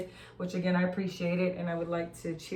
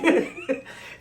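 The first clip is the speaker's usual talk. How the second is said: very high squeal of laughter; real high giggle; high shrieking laugh; 4 dB louder than usual. Real, not acted. Burst of laughter.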